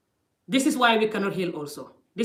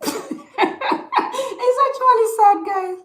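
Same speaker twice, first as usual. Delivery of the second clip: real laughter breaking through, high and loud; laughing; 9 dB louder, much slower than usual. Real, not acted.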